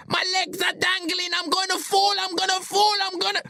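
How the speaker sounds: very high, frantic cries; panicked shouting. A narrator's reading. Billy, legs dangling, tells friends he'll fall, panicked. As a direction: cry out in rising terror, high-pitched. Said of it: "he shouts".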